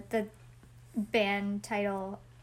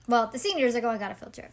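ordinary talk, nothing marked

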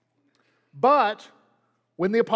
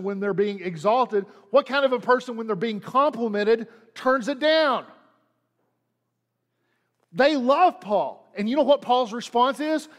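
ordinary talk, nothing marked

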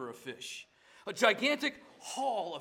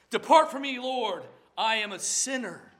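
louder, higher anguished plea; pleading cry; 4 semitones higher, 5 dB louder than usual. Acted. Peter begging the Lord to depart, a loud anguished plea. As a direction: cry out to be sent away.

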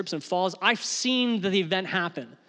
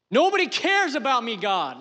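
David crying out to God, high and loud; anguished crying out; 6 semitones higher, 4 dB louder than usual. Acted. David pleading with God from the cave, anguished cry. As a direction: wail it as a loud, desperate complaint. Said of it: "this is how I imagine him. He's being vocal".

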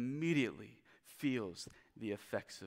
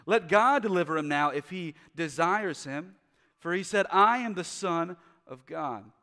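loud, higher jeering taunts; mocking priests; 7 semitones higher, 10 dB louder than usual. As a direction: sneer it at the crowd. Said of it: "mocked him, saying".

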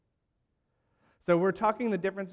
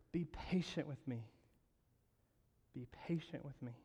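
hushed, hopeful pleading; pleading softly; 2 semitones lower, 11 dB quieter than usual. Acted. Preacher repeating the servant's soft plea. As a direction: let the plea fade almost to nothing. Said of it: "I want you to hear those words".

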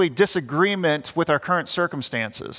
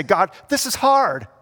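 honest lament; 5 semitones higher than usual. Retold or imitated. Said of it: "This is how you can communicate to God".

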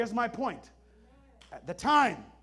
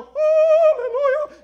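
very high, loud, sung-out hallelujah; angelic hallelujah; 16 dB louder, slower than usual. Retold or imitated. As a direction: sing it like a choir of angels. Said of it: "and the angels go".

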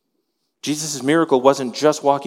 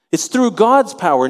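ordinary talk

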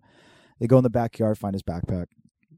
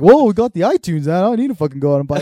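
loud, higher retold indignation; dad impression; 7 semitones higher, 10 dB louder, faster than usual. Dad refusing to rebuy the CD, loud indignant imitation. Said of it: "my dad was like".

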